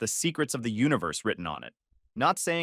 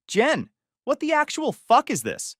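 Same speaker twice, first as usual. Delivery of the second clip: high, outraged retold confrontation; angry outburst; 13 semitones higher, 4 dB louder than usual. Man catching his girlfriend with his best friend, angry outburst. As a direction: confront her, pitch jumping way up in anger.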